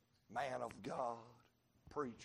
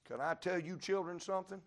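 ordinary talk, nothing marked

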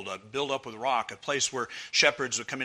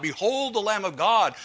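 mock cry out; 8 semitones higher, 7 dB louder than usual. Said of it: "He's the one that cried out".

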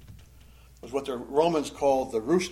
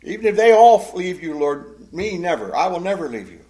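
loud, proud vow, easing after the first burst; prideful; 5 semitones higher, 11 dB louder than usual. Acted. Peter promising his Lord he will never leave, prideful. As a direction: swear it as the one loyal man. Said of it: "He's speaking pridefully".

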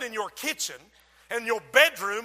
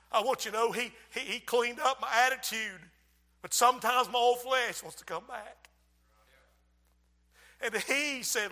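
ordinary talk, nothing marked

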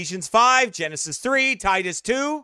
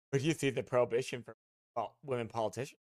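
much softer, lower, fast sneering mimicry; mocking whine; 9 semitones lower, 13 dB quieter, faster than usual. Preacher mimicking the other pastor again, fast sneering mockery. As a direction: rattle off his question like a simpering coward.

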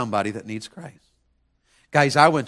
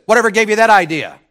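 higher, louder gruff retort; retold neighbor's retort; 8 semitones higher, 11 dB louder than usual. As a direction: toss the question back in a loud voice. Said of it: "he turned back around and he said".

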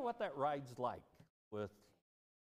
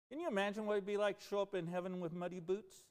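usual speaking voice

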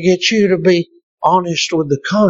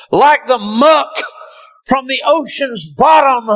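fiery preaching; 9 semitones higher than usual. Acted. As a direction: pound the congregation with it. Preaching at full force.

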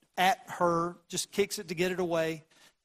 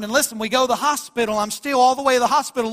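fast preaching; 5 semitones higher, 9 dB louder, faster than usual. Acted.

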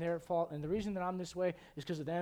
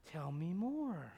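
mock sympathy; 4 dB quieter, much slower than usual. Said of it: "I'm like".

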